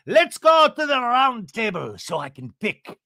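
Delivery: host it with theatrical relish; Alan Cumming flair. Retold or imitated. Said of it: "Alan Cumming would have been like".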